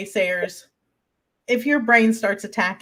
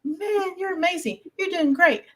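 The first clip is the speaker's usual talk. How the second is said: higher-pitched, cheerful imitation of the husband; husband's praise; 9 semitones higher than usual. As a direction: lift it up like a proud husband cheering. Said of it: "husband who constantly says".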